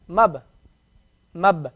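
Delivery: "Mab" with the b closed, no vowel after; sukoon on ba. Shown as a demonstration. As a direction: end "Mab" cleanly on the b. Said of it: "You hear the ba at the end".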